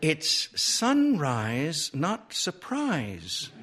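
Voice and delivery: slow, high-pitched correcting voice; flustered lady spelling out the mistake slowly; high, drawn-out, mortified correction; embarrassed correction. A narrator's reading.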